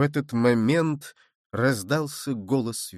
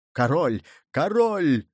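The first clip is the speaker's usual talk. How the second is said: crowd's shout; slower than usual. A narrator's reading. English soldiers spotting the supposed king, shouting. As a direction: call out, lifting the second one. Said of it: "закричали несколько англичан".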